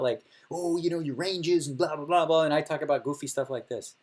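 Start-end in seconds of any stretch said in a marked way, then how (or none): 0.5-2.4 higher, mock know-it-all chatter; mocking the kids. Poker streamer mocking young players, lightly mocking. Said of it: "All the kids, when they talk".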